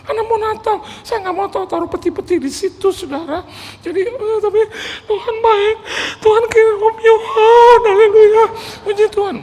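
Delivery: very high mock sobs, swelling to a loud hallelujah; imitated weeping preacher. Pastor praises God for sending donor, imitated weeping. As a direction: wail praise in a hammed-up cry. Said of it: "Daripada dia nangis terus".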